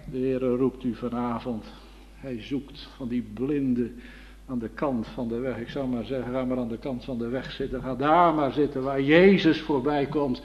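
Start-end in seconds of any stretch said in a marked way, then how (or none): none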